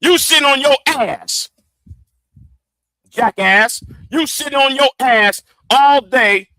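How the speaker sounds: high mocking shout. Real, not acted. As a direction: ridicule him at full volume. Mocking critic yelling at ducks.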